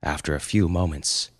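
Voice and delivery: plain reading voice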